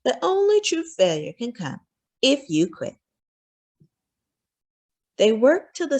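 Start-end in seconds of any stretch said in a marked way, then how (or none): none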